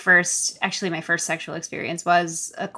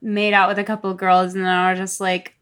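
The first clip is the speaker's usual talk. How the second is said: ordinary talk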